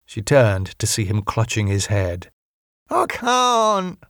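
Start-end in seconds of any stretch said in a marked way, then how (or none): 2.9-4.0 high strained cry with eyes shut; anguished cry. Acted. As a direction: wail it out in mock anguish, pitched far up. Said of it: "Wayne cried".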